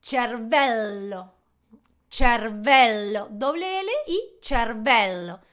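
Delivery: "cervello" very slowly, as cher-VEL-lo; slow Italian pronunciation. Shown as a demonstration. Teacher modelling the word for brain, drawn-out "cervello" with ch. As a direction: hold the double l in "cervello".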